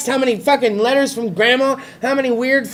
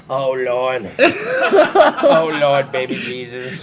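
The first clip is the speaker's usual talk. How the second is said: lower, laughing mock prayer; a touch of a scared man praying, lower; praying guy; 7 semitones lower, slower than usual. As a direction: lightly imitate a panicked man praying. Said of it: "he was just like".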